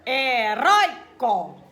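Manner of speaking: "Heróico" split, "rói" strong; stressed syllable. Shown as a demonstration. Teacher showing a paroxytone, "Heróico" broken up with emphatic "rói". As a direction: punch the middle syllable of "Heróico". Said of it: "é uma paroxítona".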